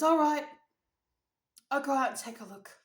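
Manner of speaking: loud burst of giving in; exasperated dad. Retold or imitated. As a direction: imitate dad loudly conceding. Dad's grudging reply acted out.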